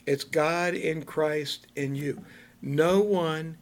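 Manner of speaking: ordinary talk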